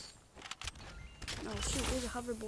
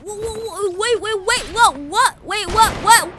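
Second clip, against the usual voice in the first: loud, much higher startled cries; 9 semitones higher, 15 dB louder than usual. Real, not acted. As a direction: caught totally off guard. Sudden surprise in game.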